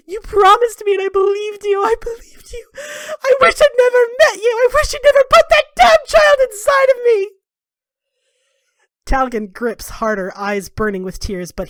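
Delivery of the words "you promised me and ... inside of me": high, loud, crying shout; screaming wail. Acted. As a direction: scream it through tears at your son. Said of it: "thrashing and kicking".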